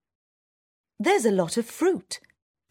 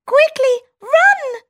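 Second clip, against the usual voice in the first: very high, loud squeaky cry; shouting mouse; 10 dB louder than usual. Acted. Town mouse warning her friend of the people, loud high squeak. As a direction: sound the alarm in a tiny voice. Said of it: "shouts the town mouse".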